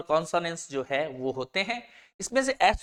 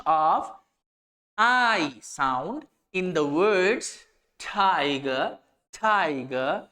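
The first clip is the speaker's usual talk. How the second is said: slow, deliberate dictation pace; slow definition reading; much slower than usual. Shown as a demonstration. Teacher reads a definition so students can copy it, slow dictation. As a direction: read it out word by word for students to note down.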